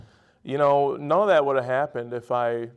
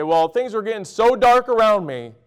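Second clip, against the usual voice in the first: mock complaint; 7 semitones higher, 5 dB louder than usual. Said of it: "and you say".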